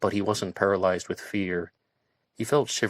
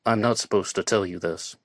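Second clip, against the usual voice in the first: ordinary delivery, nothing marked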